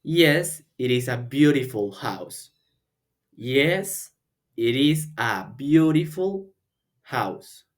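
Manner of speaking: slow, clear model line; repeat after me. Shown as a demonstration. Teacher modelling a reply for students to repeat. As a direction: say it slowly for repetition. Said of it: "Repite después de mí".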